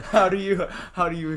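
high, loud laughing question. Real, not acted. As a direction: laugh while asking. Laughing, lost for words.

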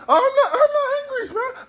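extremely high, stammering denial; hammed-up squeal of innocence; panicked slave. Slave denies anger.

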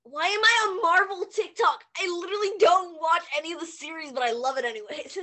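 real excitement; excited outburst. Real, not acted. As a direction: gush about it at full voice.

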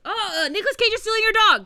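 loud, high warning call; mock alarm. Warning a stranger their dog is being stolen, loud mock call. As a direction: shout it out to warn the owner.

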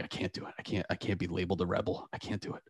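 hushed, half-whispered retold panic; choked-up nerves. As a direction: murmur it as if the throat closes. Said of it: "catches your throat".

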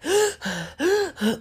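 a high mock gasp for heavy breathing; imitated panting gasp. Imitating her date's heavy panting, a high mock gasp. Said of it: "he starts breathing real heavy".

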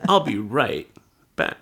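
comically sneak away, breaking before "back"; oddly halting delivery. Acted. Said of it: "Why do you say it like that?".

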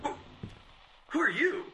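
high-pitched, alarmed questioning; startled demand. Acted. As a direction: challenge an intruder, voice leaping up. Startled writer confronts an intruder.

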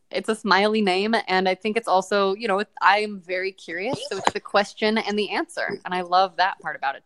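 usual speaking voice

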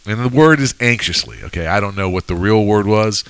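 ordinary talk

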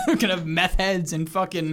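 slogan said through laughter; amused, breaking into giggles; laughing. Real, not acted. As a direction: crack up at your own joke. Cracking up at own joke slogan.

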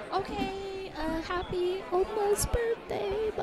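high, soft, drawn-out farewell; awkward goodbye. Retold or imitated. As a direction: back away gently in a small high voice. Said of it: "the end of our ride was like".

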